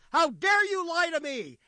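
enraged lion shout; roaring lion. Acted. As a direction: bellow it as an outraged lion king.

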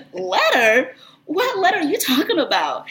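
high-pitched incredulous questions; baffled. Retold or imitated. Asking representative about unknown letter, baffled.

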